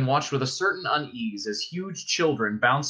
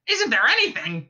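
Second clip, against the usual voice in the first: high, furious bird squawk; avian fury squawk; 9 semitones higher, 3 dB louder than usual. A narrator's reading. Ford as penguin demanding facts, furious squawk. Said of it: "squawked Ford in avian fury".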